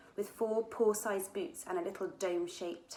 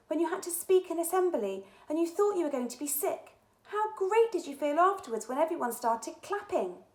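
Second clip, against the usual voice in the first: put-on girl voice; 10 semitones higher, 6 dB louder than usual. Katy encourages friend.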